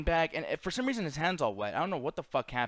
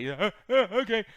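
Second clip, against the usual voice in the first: halting mock-dopey grunts, then okay; slow worker impression; 4 semitones higher than usual. Teller demonstrating how the worker sounds, halting dopey imitation. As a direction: imitate his stumbling, grunted agreement. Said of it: "but he sounds like".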